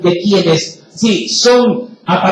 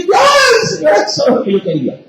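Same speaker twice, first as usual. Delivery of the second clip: mock delighted believer; 11 semitones higher than usual. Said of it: "y dicen".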